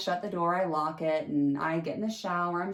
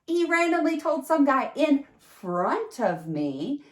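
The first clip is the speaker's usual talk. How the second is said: incredulous; 9 semitones higher, 5 dB louder than usual. Real, not acted. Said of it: "one of the big red flags".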